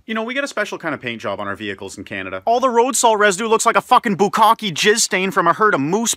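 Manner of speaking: ordinary talk, nothing marked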